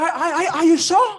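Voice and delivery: high, stammered disbelieving question; incredulous. Retold or imitated. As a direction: trip over the first word, pitch jumping up in disbelief. Startled question to friend dumping food.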